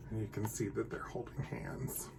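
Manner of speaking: hushed unvoiced aside; whisper. A narrator's reading. Reader whispering to the children about the bunnies. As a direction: lean in and whisper it. Aside to listeners over picture.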